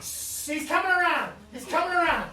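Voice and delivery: loud, high announcement; hammed-up rescuer's thrill; urgent excitement. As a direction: cry out that the rescue worked. Rescuer announcing patient's revival.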